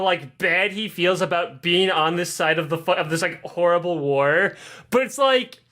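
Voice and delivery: usual speaking voice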